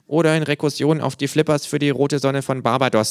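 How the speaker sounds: high kangaroo art-speak; pseudo-intellectual kangaroo. Acted. Kangaroo to art visitor, high mock-learned voice.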